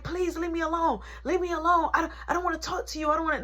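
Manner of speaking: high, fast brush-off in her voice; mimicking her. Girlfriend refusing to talk, high and hurried. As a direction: pitch it up and rush her refusal. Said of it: "She like".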